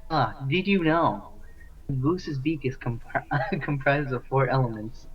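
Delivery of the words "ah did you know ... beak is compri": normal talking voice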